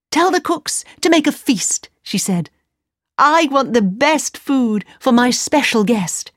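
high, commanding princess voice. A narrator's reading. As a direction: order the feast brightly.

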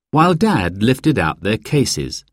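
ordinary delivery

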